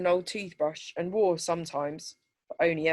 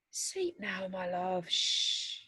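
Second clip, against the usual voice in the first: much softer, hushed soothing mother; comforting and sleepy; 8 dB quieter, much slower than usual. A narrator's reading. Mother soothing her crying daughter at night, soft and sleepy. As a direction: lull a child back to sleep. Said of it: "Mum's voice comforting and sleepy".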